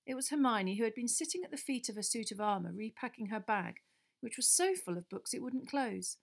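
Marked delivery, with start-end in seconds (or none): none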